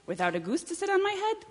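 high-pitched chicken exclamation; excited cry. Acted. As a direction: ask it in thrilled disbelief. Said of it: "cried the chicken".

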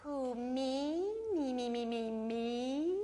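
drawn-out, sing-song "me, me, me"; feigned innocence. Acted. Snake asked if he bites, exaggerated innocent protest. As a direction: stretch and wobble it, all innocence.

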